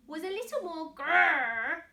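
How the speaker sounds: a little playful grrr; mock growl. Reader growling the grrr for the children.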